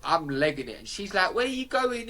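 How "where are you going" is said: put-on much higher-pitched girl's question; imitated questioning girl. Girl asks lad leaving bus stop, imitated high questioning. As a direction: mimic her question in a high voice. Retold girl calling after him.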